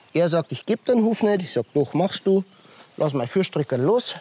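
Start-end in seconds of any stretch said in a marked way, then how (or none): none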